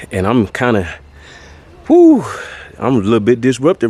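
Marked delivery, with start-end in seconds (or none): 1.9-2.2 breathless whew. Real, not acted. Winded exhale after exertion.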